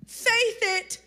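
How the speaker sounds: normal talking voice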